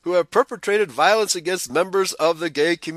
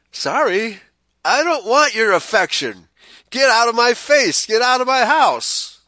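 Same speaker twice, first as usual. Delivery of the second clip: angry retort; 5 semitones higher, 4 dB louder than usual. Acted. Shouting back at an unwanted advance.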